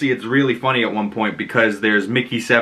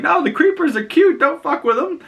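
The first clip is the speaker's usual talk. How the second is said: very high, squeaky defence; mock protective pleading. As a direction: plead for cute critters, pitched way up. Acted protective reaction to cute creatures.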